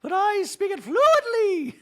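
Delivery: high, slow fussy droid; Dorit robot voice. Retold or imitated. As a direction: recite like a prissy droid. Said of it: "I do that voice for Dorit".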